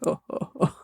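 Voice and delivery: unvoiced huffing laugh; mock breathy creature chuckle; breathy monster laugh. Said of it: "he's like".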